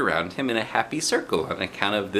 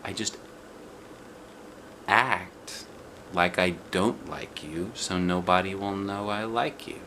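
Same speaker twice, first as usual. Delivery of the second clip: quiet; 7 dB quieter than usual. A narrator's reading. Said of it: "he said real quiet".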